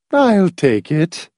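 high, slow, vacantly smiling woman; vacant smile. A narrator's reading. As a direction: offer help with an empty smile. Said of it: "smiling vacantly".

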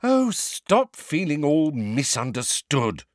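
a touch of sharpness; sharp. A narrator's reading. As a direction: cut him off sharply. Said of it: "said Hermione sharply".

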